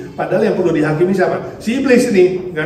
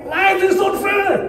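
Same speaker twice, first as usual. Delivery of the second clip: put-on high, slow whiny child yell; mock child shouting; child's yell; 14 semitones higher, much slower than usual. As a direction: wail it like a frustrated toddler. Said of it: "pengen marah, dia teriak".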